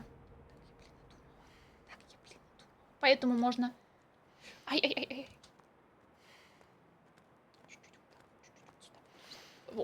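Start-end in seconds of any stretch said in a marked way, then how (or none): 0.0-2.7 hushed whisper. Real, not acted. Hiding from monster. 7.7-9.8 hushed whisper. Real, not acted. Hiding from monster.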